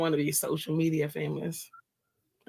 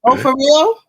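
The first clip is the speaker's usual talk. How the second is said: surprised; 6 semitones higher, 12 dB louder than usual. Real, not acted. Hears YouTube dropped the show.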